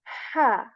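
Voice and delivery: ha said with a breathy pushed-out H from mid throat; airy ha. Shown as a demonstration. Teacher contrasting the airy ha for learners. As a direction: push air first, then ha. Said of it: "This is".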